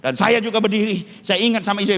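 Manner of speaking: ordinary talk, nothing marked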